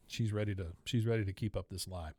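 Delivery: ordinary talk, nothing marked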